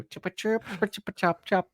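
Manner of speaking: high, quiet bird chirps; high, soft mock birdsong; silly chirping. Shown as a demonstration. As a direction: chirp away the awkward moment. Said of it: "I'm just gonna go".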